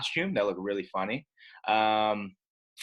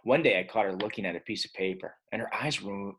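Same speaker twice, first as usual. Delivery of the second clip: usual speaking voice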